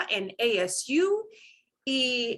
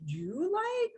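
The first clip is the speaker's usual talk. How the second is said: "you like" with "you" punched, pitched higher; 9 semitones higher, 5 dB quieter than usual. Shown as a demonstration. Teacher shows how the a-phrase punches the pronoun. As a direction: hit the "you" hard. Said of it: "So when we punch that".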